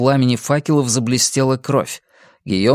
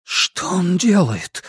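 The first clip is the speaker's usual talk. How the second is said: slow, higher-pitched dismay; hushed horror, raised pitch; horrified; 6 semitones higher, slower than usual. A narrator's reading. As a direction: ask it aghast, drawing it out. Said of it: "ужаснулся Ансель".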